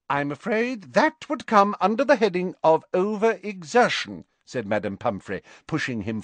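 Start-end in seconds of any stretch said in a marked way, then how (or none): 0.1-4.2 high, quick bustling nurse; brisk matron reassuring briskly. Acted. Matron figure in story.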